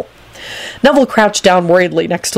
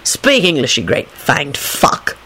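slow, low grumble; cross; 3 semitones lower, slower than usual. A narrator's reading. Lupin scolding Romanian-speaking Dragomir, cross. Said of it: "told him crossly".

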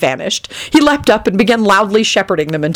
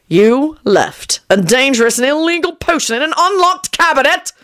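high-pitched incredulous outrage; angry bellow; 8 semitones higher than usual. A narrator's reading. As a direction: bellow the accusation, rising to the end. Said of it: "Shacklebolt bellowed".

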